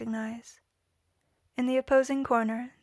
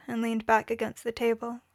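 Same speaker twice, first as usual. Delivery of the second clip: usual speaking voice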